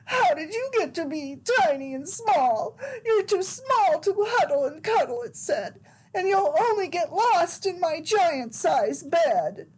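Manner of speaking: high, tearful bear with a sob in the voice; crying bear cub's voice; bawling bear. Acted. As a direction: sob it out like a lost little bear. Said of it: "hear the voice bawl".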